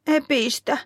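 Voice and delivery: high-pitched, pouting complaint; tearful child's protest. A narrator's reading. Niila protests at missing the trip, tearful and pouting. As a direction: protest like a hurt child close to tears. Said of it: "ja silmät täyttyvät kyynelistä".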